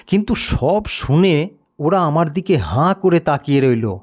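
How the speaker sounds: usual speaking voice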